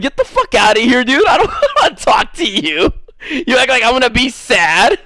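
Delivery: retold dismissal breaking into laughter; laughing mock outrage. Man retelling how he dismissed her, laughing and indignant. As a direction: raise the pitch in incredulous, laughing scorn.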